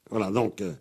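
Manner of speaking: ordinary talk, nothing marked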